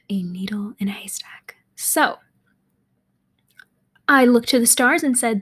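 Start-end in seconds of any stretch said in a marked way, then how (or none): 0.1-1.5 whispered. Real, not acted. Repeats phrase in a whisper.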